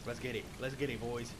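normal talking voice

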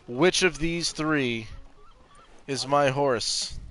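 slow, drawn-out quiz question; slow and deliberate. Shown as a demonstration. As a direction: spell it out slowly for the chat. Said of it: "I want you to guess".

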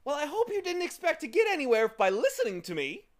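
high, loud, quick gloating; fast, high-pitched glee; gleeful. A narrator's reading. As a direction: crow it gleefully at your victim. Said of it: "said the voice gleefully".

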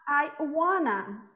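I wanna slow, wanna as WAH-nuh. Shown as a demonstration. Teacher modeling I wanna slowly. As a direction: draw out I wanna for the class. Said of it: "a pronúncia disso fica wanna".

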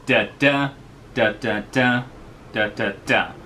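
chanted da syllables, third ones stressed; rhythmic da-da-DA chant; limerick rhythm demo. Shown as a demonstration. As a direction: beat out the meter of the line. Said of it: "that goes".

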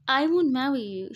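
high, loud protest; defiant refusal. A narrator's reading. Bride rejects the forced marriage, loud and defiant. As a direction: refuse at full volume.

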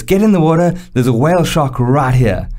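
mock skipper yelling from the boat. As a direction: shout the warning at him. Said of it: "He goes".

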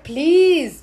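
drawn-out pleading "please"; plead, stretching the word; begging. Shown as a demonstration. Teacher translating the begging phrase, stretched pleading. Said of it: "you're begging someone".

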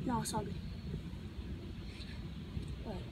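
ordinary talk

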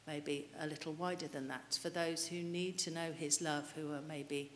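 usual speaking voice

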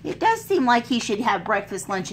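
plain reading voice

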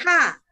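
HA high, dropping steeply; forceful falling "Ha"; sharp fourth tone. Shown as a demonstration. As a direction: start "Ha" high and drop it fast. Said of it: "Ini ada nada empat".

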